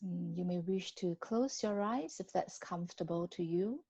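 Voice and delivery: ordinary talk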